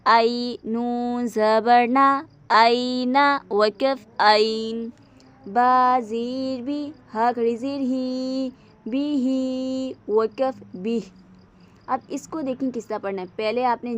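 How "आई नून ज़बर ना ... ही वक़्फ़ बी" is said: slow, louder spelling out of letters; spelling drill. Shown as a demonstration. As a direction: spell each sound slowly for a child. Drills Qaida spelling aloud. (बच्चों को धीरे हिज्जे कराते हुए)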